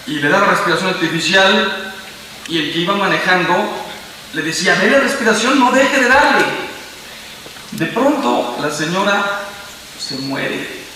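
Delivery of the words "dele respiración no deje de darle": retold urgent instruction, higher pitch; orden urgente. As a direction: urge him to keep going. Conductor urge al marido. (orden urgente, más aguda)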